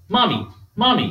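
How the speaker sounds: mock childish cry, repeated; three-year-old crying for mommy. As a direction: imitate a toddler nagging for mother. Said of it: "a three-year-old who cries".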